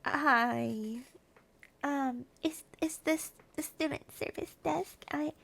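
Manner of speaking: nervous, barely-there voice; timid quiet request. Retold or imitated. A student at the service desk, softly and timidly. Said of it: "and I'm like".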